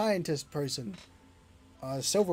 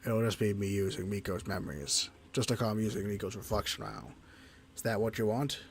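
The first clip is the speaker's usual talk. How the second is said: lower, softer sombre creature; low companion voice; 7 semitones lower, 8 dB quieter than usual. Acted. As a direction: voice the lonely companion, low and subdued.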